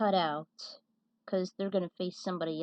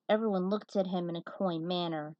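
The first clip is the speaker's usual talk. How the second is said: ordinary delivery, nothing marked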